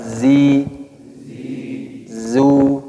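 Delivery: "Zi" then "Zu" modelled clearly; drilled syllables. Shown as a demonstration. Teacher drilling zi and zu with students. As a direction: model each syllable for repeating. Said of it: "Coba ulangi".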